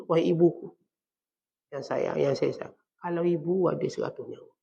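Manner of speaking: soft, unhurried words to mother; tender, respectful address. Acted. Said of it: "Kerana saat tak kata".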